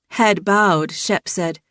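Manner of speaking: ordinary delivery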